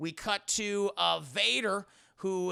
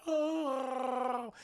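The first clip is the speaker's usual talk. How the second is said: long, higher held creature moan; Wookiee moan; 6 semitones higher than usual. Acted. Chewie stuck in the net, long moan. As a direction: draw out a Chewbacca-style groan. Chewie groans, voiced in retelling.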